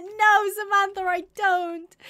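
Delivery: loud, high-pitched denial; indignant protest. Real, not acted. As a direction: protest loudly, pitch jumping up. Protests viewer's accusation of killing animals.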